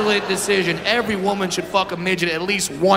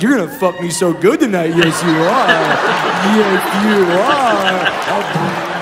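lightly voiced lover, slow and teasing; hammed-up seductive date; 4 dB louder, slower than usual. Woman picking up her date, mock seductive. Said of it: "picked up her date like".

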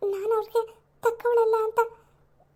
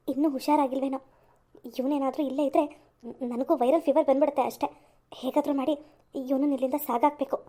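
lower, muttered scheming to herself; scheming grumble aside; 8 semitones lower than usual. Acted. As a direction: plot under your breath, annoyed. (ತನ್ನಷ್ಟಕ್ಕೆ ಗೊಣಗುವ ಕುತಂತ್ರಿ ಹುಡುಗಿ)

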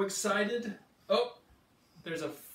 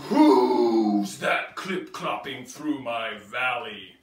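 loud, gruff T-Rex voice; roaring T-Rex; 6 semitones lower, 11 dB louder than usual. Acted. T-Rex challenging the little triceratops, loud monster voice. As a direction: boom it like a big dinosaur.

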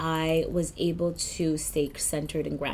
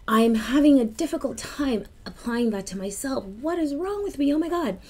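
higher-pitched, frustrated with herself; exasperated self-questioning; 7 semitones higher than usual. Retold or imitated. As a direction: ask yourself in exasperation, pitch climbing.

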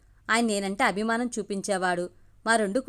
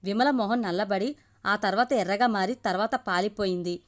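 ordinary delivery, nothing marked

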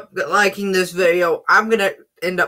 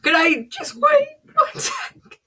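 high pitched cry; slower than usual. Real, not acted. Reacting to pepper bite.

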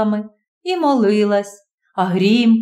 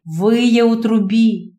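ordinary delivery, nothing marked

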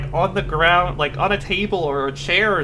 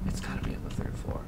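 fast urgent whisper; 11 dB quieter, faster than usual. Real, not acted. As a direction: hiss it under your breath. Whispers while hiding.

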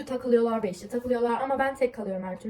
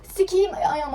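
pained curse; 8 semitones higher, 6 dB louder, slower than usual. Real, not acted. Hurts her foot mid-talk.

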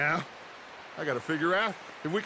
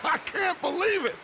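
hammed-up shock at the prisoner; mock outrage; 12 semitones higher than usual. Gang leader greets the captured O'Driscoll, theatrical disbelief.

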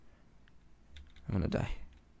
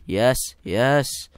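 normal talking voice

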